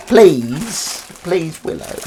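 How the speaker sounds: high, pleading with a pet; drawn-out, high plea; begging. Real, not acted. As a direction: beg the cat to come. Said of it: "Begging with Cats".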